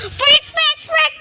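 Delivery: loud excited yells; shouting. Real, not acted. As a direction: shout it out repeatedly. Kids shouting during hide and seek. (malakas na sigaw)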